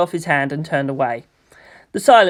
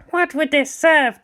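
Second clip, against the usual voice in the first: put-on old lama's voice; 11 semitones higher than usual. Old lama's questioning line.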